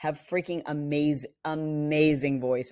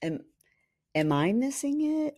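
puzzled high question; 10 semitones higher than usual. Retold or imitated. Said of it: "then you go".

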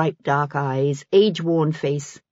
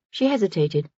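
usual speaking voice

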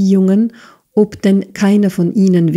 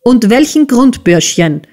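raised, menacing question, high then dropping; tense, threatening demand; grinding anger; 6 semitones higher, 4 dB louder than usual. A narrator's reading. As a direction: press the boy through gritted teeth. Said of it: "knirschte Squeers".

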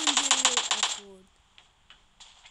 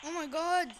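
normal talking voice

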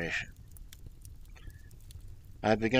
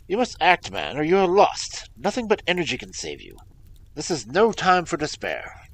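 louder, higher, pressing him to act; urgent command; 5 semitones higher, 8 dB louder than usual. A narrator's reading. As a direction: rouse the man from despair. Holmes urging despairing client.

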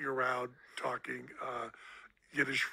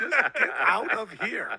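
louder mock yell, kid voice; mock angry kid; 8 semitones higher, 8 dB louder than usual. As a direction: bark it like a cross kid. Repeating the son's line.

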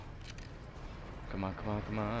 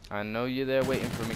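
usual speaking voice

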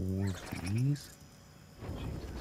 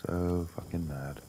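sulky grumble; 4 semitones lower, 4 dB quieter, much slower than usual. Real, not acted. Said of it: "I'm so mad".

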